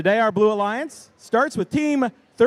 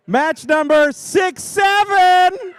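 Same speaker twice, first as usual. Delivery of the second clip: much higher, louder, slow hype call; announcer shout; 10 semitones higher, 5 dB louder, slower than usual. Shown as a demonstration. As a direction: belt it like a ring announcer. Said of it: "oh yeah, I'm gonna do it".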